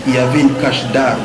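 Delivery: usual speaking voice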